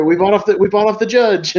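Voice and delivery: high, gleeful mock boast; boastful mobster. Mobster boasting to Angelo about the judge, high mock glee. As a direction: crow it up high like a proud crook.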